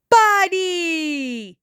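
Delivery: overjoyed cry to his best pal; excited exclaim. A narrator's reading. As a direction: gush it out happily. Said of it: "he exclaimed".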